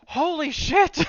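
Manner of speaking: put-on high, loud, thrilled little-girl yell. A four-year-old yelling at the hibachi chef, high and loud. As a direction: shout it like a wowed small child. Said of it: "turns to everyone and goes".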